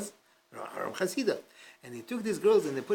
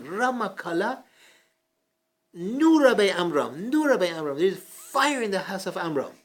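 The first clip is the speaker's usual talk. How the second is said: retold scream; 4 semitones higher, 7 dB louder than usual. Rav Amram yelling fire so the sages rush over, loud retold shout. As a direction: shout it like raising a fire alarm to bring people running. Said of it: "He started screaming".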